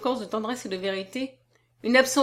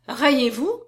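high, louder, rising question; stunned; 4 semitones higher, 6 dB louder, slower than usual. A narrator's reading. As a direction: ask it in sheer astonishment. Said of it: "demanda Edmé stupéfaite". (question stupéfaite, voix montante)

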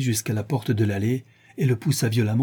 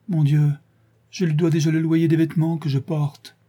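higher-pitched pleading; anxious plea; 5 semitones higher than usual. A narrator's reading. Indebted woman pleads with the man threatening her, anxious and higher.

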